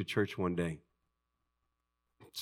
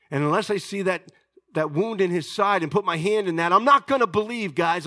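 insistent refusal; 7 semitones higher, 6 dB louder than usual. Acted. Voicing Thomas stubbornly refusing.

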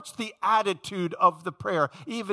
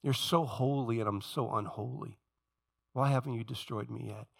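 lowly prayer; 6 semitones lower, 6 dB quieter than usual. Retold or imitated. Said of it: "I cry out to my God".

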